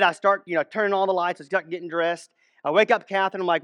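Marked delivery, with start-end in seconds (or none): none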